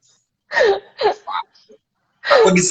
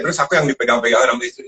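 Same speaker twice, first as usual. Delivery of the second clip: usual speaking voice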